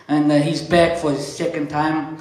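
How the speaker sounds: normal talking voice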